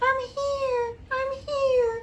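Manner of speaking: high, soft far-off voice; muffled from below. A narrator's reading. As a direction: call up faintly from underground. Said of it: "Little Sid's muffled voice".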